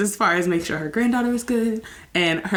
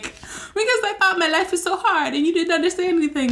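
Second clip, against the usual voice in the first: much higher, quick, whining self-pity; whiny younger self; 13 semitones higher than usual. Retold or imitated. [name] mimicking her younger self apologizing to mom, high and whiny. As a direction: play her bratty twenty-something self. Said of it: "And I'm like".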